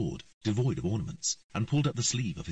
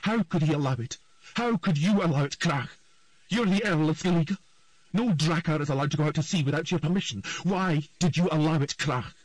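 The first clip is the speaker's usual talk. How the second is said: loud, higher accusing tirade; furious queen shouting; 9 semitones higher, 5 dB louder than usual. A narrator's reading. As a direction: berate the earl, each question hitting harder. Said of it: "shouted the queen".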